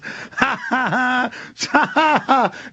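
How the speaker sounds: high-pitched chuckling laugh. Real, not acted. Talker amused by his lie to the feds, high laugh. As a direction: laugh heartily at your own fib.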